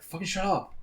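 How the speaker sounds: high-pitched mock irritation; imitated annoyed teammates. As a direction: impersonate a fed-up player telling off a kid. Teammates snapping at the annoying kid.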